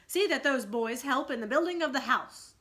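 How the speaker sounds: put-on boy captain directing his crew; captainy voice. A narrator's reading. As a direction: give the order like a proud young captain. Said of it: "in his most captainy voice".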